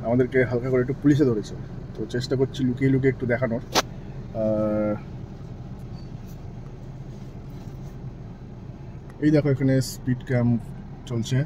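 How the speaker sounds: hushed and low so the police won't hear; quiet, hidden commentary; speaking softly. Real, not acted. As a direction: keep it low, almost under your breath. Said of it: "আস্তে আস্তে বলছি".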